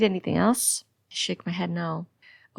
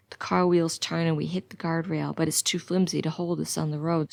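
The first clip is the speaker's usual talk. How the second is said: plain reading voice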